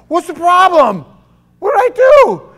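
very high, loud mock innocence; hammed-up high protest after being grabbed; high indignant protest. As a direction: squeal it like a wronged teenager.

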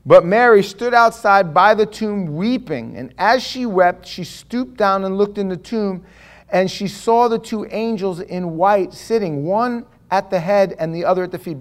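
measured formal scripture read. Acted. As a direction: read it solemnly to the church. Reads scripture passage slowly.

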